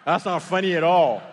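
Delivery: unamused and forceful. Acted. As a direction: raise the volume on a bad-joke complaint. Retort repeated with more force.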